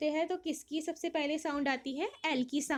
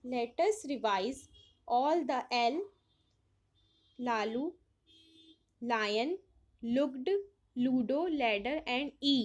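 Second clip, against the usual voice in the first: slow reading; much slower than usual. Acted. Reads book instructions aloud.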